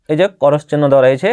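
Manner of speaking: normal talking voice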